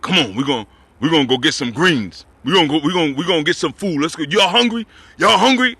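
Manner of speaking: put-on hearty uncle rounding up the kids; a big man's eager call to food. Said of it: "He had a big, deep voice like that".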